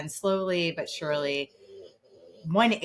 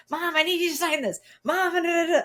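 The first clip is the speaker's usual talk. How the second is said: put-on high, fast, whiny kid voice; imitating nagging kids; 11 semitones higher than usual. Mother mimicking her kids' demands, high and rushed. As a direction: rattle off demands like an impatient child.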